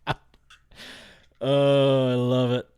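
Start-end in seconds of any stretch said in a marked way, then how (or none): none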